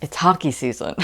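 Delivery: normal talking voice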